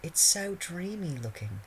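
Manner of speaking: put-on slow, high, dreamy girl. A narrator's reading. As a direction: gush over it dreamily. Romilda gushing.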